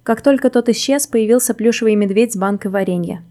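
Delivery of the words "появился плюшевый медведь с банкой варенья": ordinary delivery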